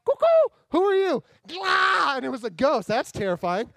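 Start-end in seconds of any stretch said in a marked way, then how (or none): 0.0-2.2 high, loud panicky cry; spooked shriek. Acted. Disciples in the boat crying out at a ghost, high and loud. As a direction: shriek like you just saw a ghost.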